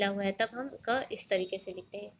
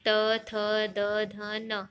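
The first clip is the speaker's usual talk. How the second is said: sounding out letters; much slower than usual. Shown as a demonstration. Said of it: "दंत".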